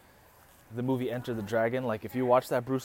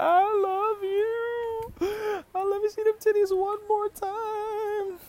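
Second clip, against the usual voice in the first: hammed-up falsetto wail, words stretched way out; mock crying; much slower than usual. Tearful boyfriend says goodbye to his girlfriend, mock crying. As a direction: bawl it very high and slow, badly faked. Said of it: "was crying like".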